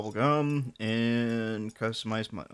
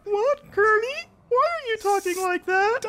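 squeaky cartoon voice. Squeaky Muppet voice bit.

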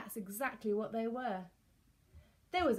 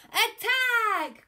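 high, loud shout; captain's full-voiced attack call; battle cry; 13 semitones higher, 11 dB louder than usual. A narrator's reading. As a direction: yell the charge. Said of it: "cried Captain Flynn".

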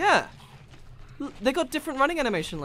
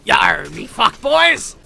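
slow, loud pirate bellow; hammed-up pirate cry; 9 dB louder, much slower than usual. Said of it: "I need to practice that".

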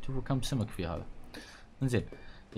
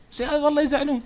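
much higher, louder whine; whiny outburst; 8 dB louder than usual. Real, not acted. Gamer whining to viewers that the villagers sulk. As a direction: whine like you're at the end of your rope.

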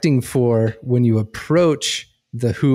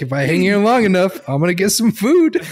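amused fish voice; 8 semitones higher, 3 dB louder than usual. Pretend. Voicing the fish's thoughts, laughing.

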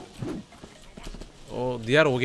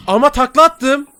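higher, louder, slow excited comment; excited outburst; 11 semitones higher, 8 dB louder than usual. Real, not acted. Gamer excitedly noticing the same tattoo, loud and high. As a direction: exclaim it with delight.

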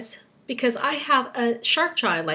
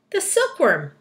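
higher exclamation of realization; crying out; 6 semitones higher, 5 dB louder than usual. A narrator's reading. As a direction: announce the answer eagerly. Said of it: "cried the old green grasshopper".

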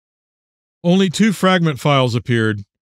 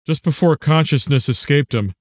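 usual speaking voice

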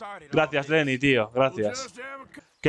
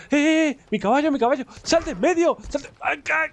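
panicked yelling; 11 semitones higher than usual. Real, not acted. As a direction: yell in sudden panic. Horse running into crowd. (gritos agudos de pánico)

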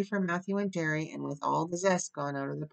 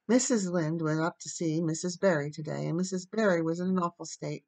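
usual speaking voice

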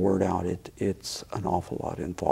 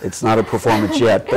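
words broken by laughter, louder; laughing; 2 semitones higher, 9 dB louder than usual. Real, not acted. Guest teasing the host about calling it a performance, laughing. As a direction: laugh through a gentle tease. Teasing over the host's slip.

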